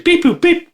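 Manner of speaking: squeaky beep boop noises; high robot beeps. Retold or imitated. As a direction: chirp like R2-D2.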